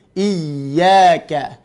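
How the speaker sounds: IYYAKA with firm weight on the doubled Y; pressed ya. Shown as a demonstration. Teacher showing required pressure, stressed YY in IYYAKA. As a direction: lean on IYY, then release into YAKA. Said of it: "ada tekanan".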